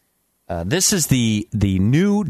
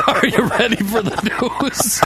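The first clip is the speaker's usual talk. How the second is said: ordinary talk, nothing marked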